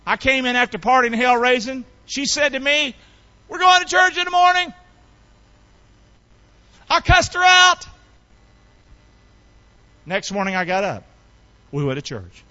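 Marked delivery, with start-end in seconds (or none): 3.5-4.7 high, imitated motherly declaration; mother's announcement. Mother's announcement voiced in testimony. 6.9-7.9 high, loud preaching shout; loud outburst. Real, not acted. Preacher bursts out recalling quarrel.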